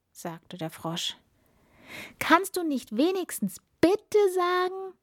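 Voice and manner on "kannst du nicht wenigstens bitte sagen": very high, affronted princess voice; indignant. A narrator's reading. Princess scolding the frog at table, indignant. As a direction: demand manners, pitch shooting up. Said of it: "fragte die Prinzessin empört".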